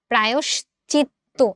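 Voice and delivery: প্রায়শ্চিত্ত slowly, holding the double "tt"; doubled consonant. Shown as a demonstration. Teacher modelling the answer, প্রায়শ্চিত্ত slow with held "tt". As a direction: spell out প্রায়শ্চিত্ত by sound, "prayosh-chit-to". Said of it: "আমরা এটাকে double করে দিচ্ছি".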